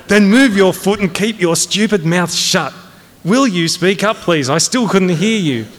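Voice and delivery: high-pitched, exasperated barking; angry command. Acted. Colonel silencing major and prodding cadet, angrily. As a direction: bark orders in all directions.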